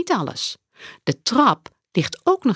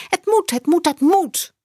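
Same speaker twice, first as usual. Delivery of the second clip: kinderstemmetje Saar; 11 semitones higher, 3 dB louder than usual. A narrator's reading. Saar voiced by the narrator.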